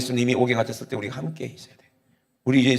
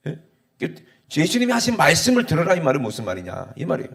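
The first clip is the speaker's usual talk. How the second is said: usual speaking voice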